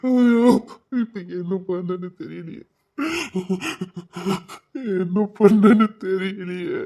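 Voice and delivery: helpless crying lament; tearful despair. Acted. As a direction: wail helplessly, stretching it out at the end. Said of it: "அழாதீங்க தா".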